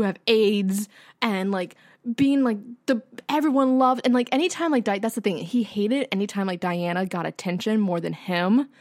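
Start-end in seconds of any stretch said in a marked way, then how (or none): none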